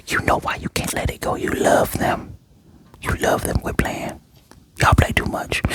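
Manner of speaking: hushed, breathy whisper of knowing teasing. Real, not acted. Whispering to Yor and Loid that they love each other. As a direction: whisper it like a secret you're in on. Speaker whispers to show's characters.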